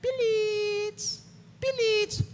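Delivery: a mother's high call to her newborn; mom calling the baby. Retold or imitated. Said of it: "Sabi niya".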